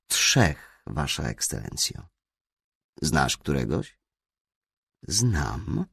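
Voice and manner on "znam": careful, guarded reply; cautious. A narrator's reading. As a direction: answer warily, holding back. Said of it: "odparłem ostrożnie". (ostrożnie, z rezerwą)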